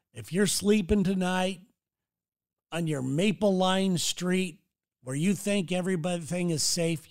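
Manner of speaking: normal talking voice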